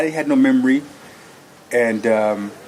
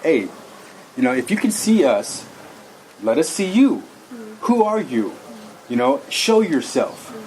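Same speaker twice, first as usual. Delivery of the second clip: rising, upset call to something unseen, pitched higher; upset challenge; 4 semitones higher than usual. Retold or imitated. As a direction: demand it show itself, getting worked up. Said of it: "I started getting upset".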